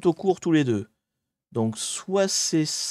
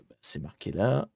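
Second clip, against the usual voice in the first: ordinary talk, nothing marked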